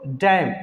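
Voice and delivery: डैम ending on M; silent N. Shown as a demonstration. Teacher answers his question, डैम without N. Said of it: "क्या पढ़ेंगे इसको".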